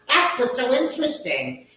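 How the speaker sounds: high, loud cackling ghoul host; Crypt Keeper voice. Pretend. Cackling Crypt Keeper host greeting his viewers. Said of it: "I almost feel like the Crypt Keeper".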